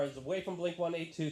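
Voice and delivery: ordinary delivery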